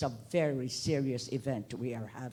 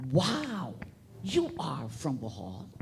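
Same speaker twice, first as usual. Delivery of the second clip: quoted wow, then asking with a lift on Bohol; impressed question; slower than usual. Retold or imitated. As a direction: retell an interviewer's surprised question. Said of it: "And he asked me".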